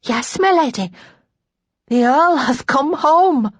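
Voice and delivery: high, loud maid's voice, slowing at the end; drawn-out, thrilled high pitch; excited announcement. A narrator's reading. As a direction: proclaim the momentous news. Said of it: "feverish excitement".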